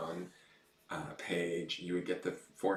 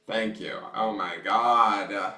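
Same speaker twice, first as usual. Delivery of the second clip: ordinary delivery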